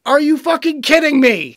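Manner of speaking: loud, high yell of disbelief; incredulous angry shout; outraged outburst. Real, not acted. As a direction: explode in exasperated disbelief. Outburst at the article's warning.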